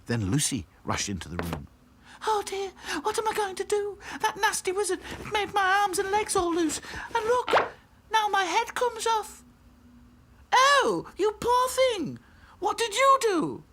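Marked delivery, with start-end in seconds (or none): 2.3-9.4 very high, distressed rag-doll voice; wailing Lucy. A narrator's reading. Lucy lamenting the wizard's spell, wailing. Lucy wails over loose limbs. 10.5-13.6 very high, loud, drawn-out sympathy; shocked princess doll. A narrator's reading. Princess dolls pitying Lucy, shocked.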